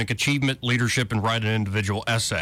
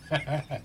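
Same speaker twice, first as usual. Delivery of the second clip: soft chuckle; helpless little laugh at the news; 6 dB quieter than usual. Real, not acted. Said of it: "I'm sorry I'm laughing".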